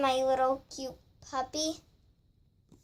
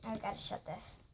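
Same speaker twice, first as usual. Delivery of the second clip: usual speaking voice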